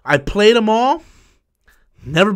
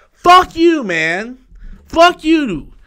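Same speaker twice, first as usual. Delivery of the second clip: higher, louder angry cursing; angry yell; 8 semitones higher, 3 dB louder, much slower than usual. Real, not acted. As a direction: spit it right at the troll. Cursing at the troll.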